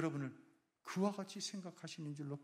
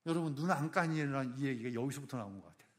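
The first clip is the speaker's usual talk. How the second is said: usual speaking voice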